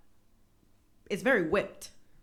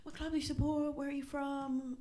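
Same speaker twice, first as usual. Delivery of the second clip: mock chirpy workplace voice; 6 semitones higher, 4 dB quieter than usual. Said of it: "the first thing I ask people is".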